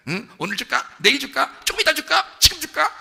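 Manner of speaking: put-on dad playfully teases his laughing child; teasing father. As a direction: tease the kid before giving the gift.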